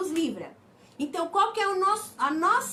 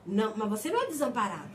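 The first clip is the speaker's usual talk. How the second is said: voicing the Lord; 7 semitones lower, faster than usual. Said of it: "Mas o Senhor fala".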